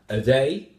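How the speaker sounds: "A day" as its own group, loud on "day"; phrasal grouping. Shown as a demonstration. Teacher showing words that go together, "A day" as a separate group. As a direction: set "A day" apart as one chunk. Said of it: "that's grouped".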